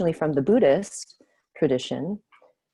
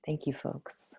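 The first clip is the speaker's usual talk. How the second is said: soft whispered thanks; 12 dB quieter, slower than usual. Real, not acted. Thanking the group after the check-in, whispered. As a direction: breathe the thanks out, no voice.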